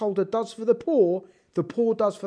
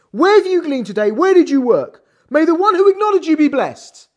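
loud quoted question; 6 semitones higher, 8 dB louder than usual. Retold or imitated. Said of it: "She said like this".

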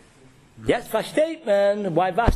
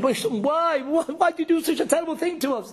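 anguished outcry; 7 semitones higher than usual. Retold or imitated. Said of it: "we're screaming".

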